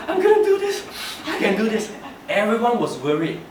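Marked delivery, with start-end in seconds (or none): 0.1-1.9 high, loud, laughing through a fake sob; mock tearful boy. To the teachers, the little boy insists through laughter-soaked sobs. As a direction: plays his sobbing child self, pushing bravely through.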